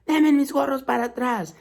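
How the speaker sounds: high, put-on peddler voice demanding caps; scolding peddler. A narrator's reading. Vendedor regañando a los monos, voz aguda. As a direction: make the monkeys hand them back. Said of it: "él le empezó a decir a los monos".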